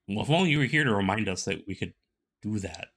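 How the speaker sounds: normal talking voice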